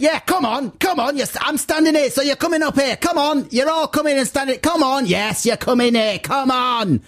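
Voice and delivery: relentless high-pitched beckoning, mock matriarch; hammed-up high hectoring voice; loud matriarch. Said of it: "But she said".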